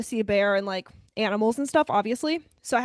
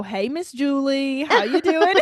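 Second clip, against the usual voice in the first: imitated friendly greeting; 2 semitones higher, 3 dB louder, slower than usual. Camp worker greeting Angelo's mom, imitated drawn-out hello. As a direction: stretch out the greeting, playfully imitating him.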